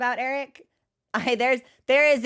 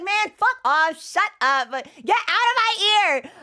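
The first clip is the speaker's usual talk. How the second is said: higher, louder fed-up brush-off; mock exasperated outburst; 9 semitones higher, 5 dB louder than usual. She snaps back at the devil in her head, exasperated. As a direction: shoo the devil away, exasperated. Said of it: "And I'm just like".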